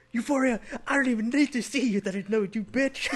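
high-pitched girlfriend impression. Host acts out girl finishing the hero's sentence, high-voiced mimicry. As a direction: jump up high for the interrupting girl. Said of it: "then his girl comes out of nowhere".

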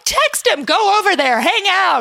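high, loud, eager urging; mock tipsy egging-on. Tipsy friend urging a woman to text him, hammed-up. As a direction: push her into it with hammed-up enthusiasm. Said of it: "You're like".

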